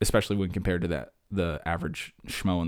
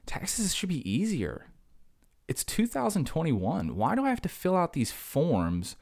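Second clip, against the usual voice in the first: exasperated whine; 8 semitones higher, slower than usual. Real, not acted. Exasperated rant about tax forms.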